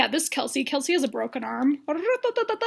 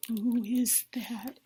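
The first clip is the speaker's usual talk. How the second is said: soft, breathed, very slow; mock awed hush; 2 semitones lower, 8 dB quieter, much slower than usual. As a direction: whisper it in pretend amazement. Imitates awestruck date spotting singer.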